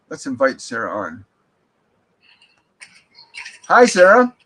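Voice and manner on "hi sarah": high, loud, beaming hello; excited greeting. Real, not acted. Medium welcomes Sarah onto the live stream, high and loud with excitement. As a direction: greet her like a long-lost friend.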